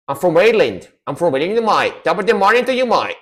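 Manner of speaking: a stage Irishman greeting; mock Irish accent.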